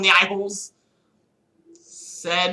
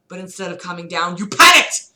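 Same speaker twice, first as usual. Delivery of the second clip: ordinary talk, nothing marked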